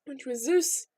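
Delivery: usual speaking voice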